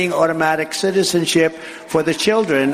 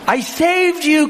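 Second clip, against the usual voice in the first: high, slow, lightly voiced wounded woman; a hint of a stunned woman; mock hurt woman's cry; 12 semitones higher, slower than usual. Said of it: "cried the woman".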